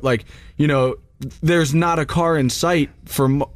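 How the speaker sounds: usual speaking voice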